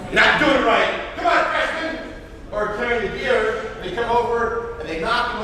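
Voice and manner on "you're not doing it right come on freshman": higher, louder scolding of a freshman; hammed-up senior chewing out a rookie; mock yelling at freshmen. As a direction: bark at the freshman like an upperclassman. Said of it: "You're gonna yell at them".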